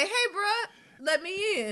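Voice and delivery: high squeaky pleading dog voice, laughing; laughing high-pitched dog plea; dog's voice. Retold or imitated.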